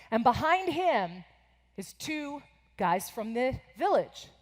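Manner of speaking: normal talking voice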